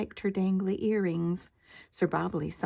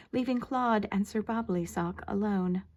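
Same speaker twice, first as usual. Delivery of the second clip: ordinary delivery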